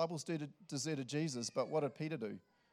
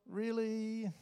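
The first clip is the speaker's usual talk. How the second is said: high, drawn-out "really"; mock disappointed disbelief; judgmental; 9 semitones higher than usual. Preacher guessing Jesus's look at Peter, judgmental.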